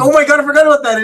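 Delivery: high, loud, rushed outburst; excited remembering. Real, not acted. Friend reminded of the movie romance, excited outburst. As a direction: blurt it out fast, thrilled to remember. Suddenly remembers a plot point.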